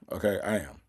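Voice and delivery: usual speaking voice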